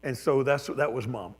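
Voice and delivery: ordinary talk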